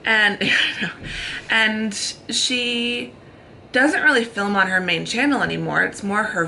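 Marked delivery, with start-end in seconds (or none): 0.4-1.5 high breathy laugh; quick giggle; laughter. Real, not acted. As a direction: laugh at the joke. Laughs at friend's joke.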